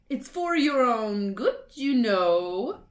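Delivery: sanctimonious poltergeist; mock-saintly. A narrator's reading. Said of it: "in a saintly voice".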